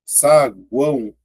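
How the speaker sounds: Saguão said slowly, U glide audible; slow syllable split. Shown as a demonstration. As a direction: stretch Saguão out for the students. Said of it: "ó, cortei".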